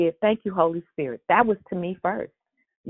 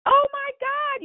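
surprised; slower than usual. Real, not acted. Hears who invited caller.

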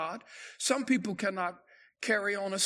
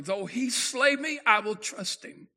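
ringing out higher and louder in triumph; triumphant; 4 semitones higher, 7 dB louder than usual. Retold or imitated. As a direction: proclaim it like a victory cry. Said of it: "what Job said so triumphantly".

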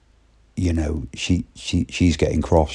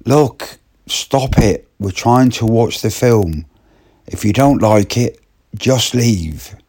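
high, sharp telling-off; irritated woman; 5 semitones higher than usual. Retold or imitated. Woman scolding Martin during the film, high and cross. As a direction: snap at him to stop. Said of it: "the lady in question".

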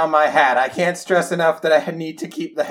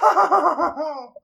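long, high wavering "no"; wailed no; 9 semitones higher than usual. Acted. Player protesting Spider's release, hammed-up wail. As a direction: wail it out, breaking into laughter.

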